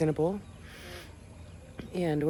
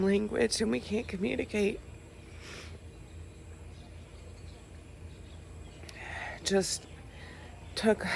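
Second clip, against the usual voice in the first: real tears, soft and broken; crying through the words, long pauses; snotty crying; 6 dB quieter than usual. Real, not acted. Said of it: "Sorry for the ugly crying face".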